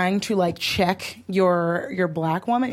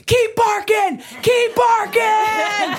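high, loud, slow shout out the window; shouting at the barking man from the car, defiant; yelled taunt; 11 semitones higher, 3 dB louder, much slower than usual. Retold or imitated. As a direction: hurl it back at him from the cab.

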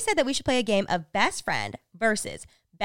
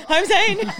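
laughing intro; 7 semitones higher, 5 dB louder than usual. Real, not acted. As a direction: giggle through your own name. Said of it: "I got nervous".

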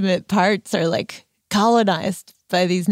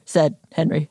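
normal talking voice

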